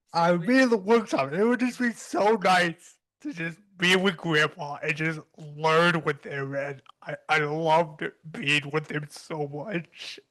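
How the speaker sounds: choked up, breaking into teary laughter; brought to tears. Real, not acted. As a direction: get through a fond memory while crying. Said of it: "I brought myself to tears".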